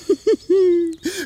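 a high, bursting boastful laugh; a cocky little chuckle; hearty laugh. Acted. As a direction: laugh it off like it's nothing. Karlsson laughs at the question.

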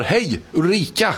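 calling out. Pretend. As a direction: call out hopefully to Ulrika, slowly. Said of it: "och ropar".

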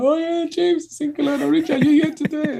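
high put-on women's voices; older women's greeting. Older women greet their karaoke friend, gushing imitation.

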